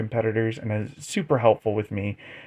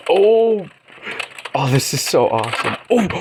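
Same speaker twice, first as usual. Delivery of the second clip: excited; 3 semitones higher, 3 dB louder than usual. Real, not acted. Reacting to a great flick.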